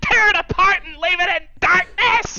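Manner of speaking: very high, strained mock-tragic yelling; hammed-up anguished shout. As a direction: wail it like a betrayed movie hero. Acting out Star Wars betrayal scene.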